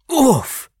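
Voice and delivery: high, sharp grunt of lost breath; winded oof. Acted. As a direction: knock the wind out with a high oof. Sister lands on belly.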